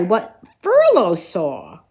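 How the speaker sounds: plain reading voice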